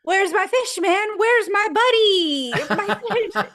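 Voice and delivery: high, loud, drawn-out wail for the fish; frantic mock Eddie. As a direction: plead desperately for his buddy. Eddie frantic over his fish.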